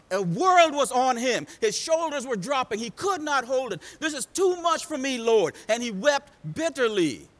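high, loud run of mounting short phrases; impassioned sermon crescendo; full-force preaching. Real, not acted. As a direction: build the misery in hammered phrases.

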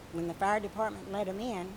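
usual speaking voice

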